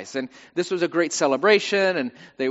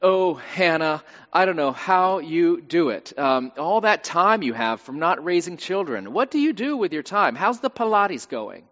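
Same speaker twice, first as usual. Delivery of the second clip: backhanded compliments; 2 semitones higher than usual. Acted. Peninnah needling childless Hannah at the family table, mock-sweet. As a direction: act out a rival's fake-friendly dig. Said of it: "things that are like compliments, but not".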